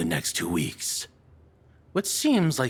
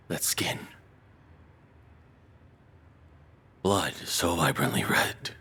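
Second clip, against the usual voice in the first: obsessed whisper; 3 semitones lower than usual. Acted. Captor lost in obsession over the captive, whispering. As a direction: whisper it, savouring every word.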